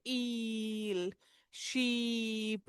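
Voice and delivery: ईल and शीप with very stretched 'eee'; slow, drawn-out vowel in ईल, शीप; long E. Shown as a demonstration. Teacher giving double-E examples, long ईल, शीप. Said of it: "double ई long ई का sound बनाते हैं".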